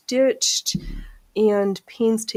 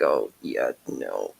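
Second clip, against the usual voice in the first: much lower, deadpan mock verdict; mock deep voice; 5 dB quieter than usual. Retelling checking the cat, mock deep deadpan voice. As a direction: drop way down for the joke. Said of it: "and I was like".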